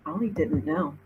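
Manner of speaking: plain reading voice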